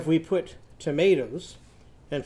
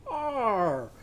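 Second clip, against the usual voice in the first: long "ar" sliding down from high; drawn-out "ar" sound; 5 semitones higher than usual. Shown as a demonstration. Teacher hangs from a high bar, drawn-out falling "ar". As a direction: hang on the "R" and let it fall. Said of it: "hang there and go".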